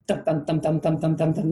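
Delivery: rapid, even string of thumps; racing heartbeat. Retold or imitated. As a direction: imitate a pounding heart with quick repeated beats. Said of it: "the heart just beating, racing".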